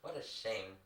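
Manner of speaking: ordinary talk, nothing marked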